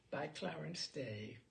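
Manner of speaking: ordinary delivery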